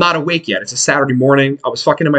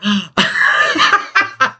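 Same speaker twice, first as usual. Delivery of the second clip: exaggerated gasp at the news; mock horrified gasp; 5 semitones higher than usual. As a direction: gasp in acted-out alarm. Retold shocked look at the girl.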